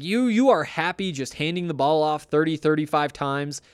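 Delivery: ordinary talk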